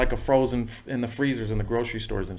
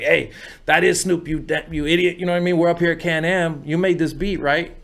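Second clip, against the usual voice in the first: higher, scratchy impression of an impatient friend; 6 semitones higher, 3 dB louder than usual. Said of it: "His voice was kinda scratchy like that".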